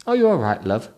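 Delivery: high, camp, concerned cinema attendant; effeminate concerned attendant. Acted. Attendant checks on fallen Trevor, high camp concern. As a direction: ask gently in a camp, high voice.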